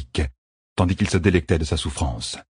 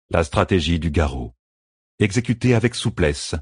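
usual speaking voice

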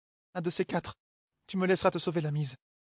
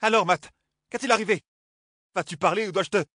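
demanding; 3 semitones higher, 5 dB louder than usual. Acted. Healer interrogating Matt sharply.

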